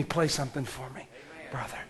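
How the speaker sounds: quick whispered aside; a private whisper to the musician. Real, not acted. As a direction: whisper it off-mic to the keyboard player.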